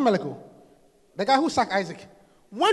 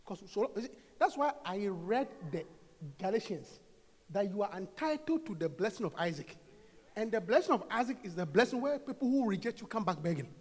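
quiet calm teaching; 3 semitones lower, 5 dB quieter than usual. Real, not acted.

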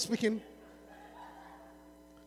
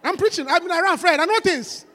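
normal talking voice

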